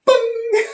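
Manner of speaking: a loud, very high "bing" like a phone alert; imitated phone ding. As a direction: ring it out like a phone going off in a silent room. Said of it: "it goes".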